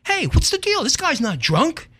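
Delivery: loud, high indignant call, slowing at the end; indignant protest. Retold or imitated. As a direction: demand an answer from the officer. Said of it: "I say".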